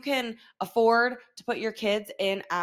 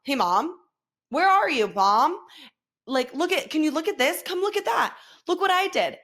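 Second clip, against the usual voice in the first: put-on pestering kid, pitch climbing as he goes; mimicked eager child calling for mom; imitating ten-year-old; 5 semitones higher, 3 dB louder than usual. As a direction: beg mom to look, higher and higher. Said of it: "here comes my ten-year-old".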